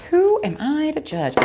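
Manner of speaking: high, put-on voice; playful high voice. Card reader shrugging off a strange card, playful high voice.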